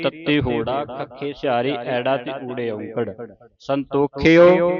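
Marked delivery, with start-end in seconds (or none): 3.6-4.7 ਸੰਤੋਖਿਓ slowly as "san-to-khe-o"; louder, drawn-out ਸੰਤੋਖਿਓ with each syllable sounded; spelled-out syllables. Shown as a demonstration. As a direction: hold the "to" and final "o" of ਸੰਤੋਖਿਓ. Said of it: "ਸੱਸੇ ਟਿੱਪੀ".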